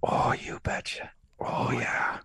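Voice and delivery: mock Midwestern, all in a whisper; whispered Midwestern accent. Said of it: "whisper and do a Midwestern accent".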